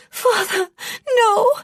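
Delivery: very high, loud scream of horror; piercing cry of refusal. A narrator's reading. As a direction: scream a desperate plea to a father. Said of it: "Pansala screamed".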